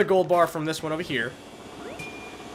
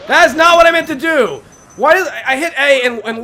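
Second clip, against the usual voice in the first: frustrated shout; 7 semitones higher, 10 dB louder than usual. Real, not acted. Luigi walks the wrong way.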